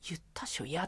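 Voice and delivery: a hushed Hokkaido comeback; whispered Hokkaido retort. Shown as a demonstration. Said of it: "って突っ込んだ".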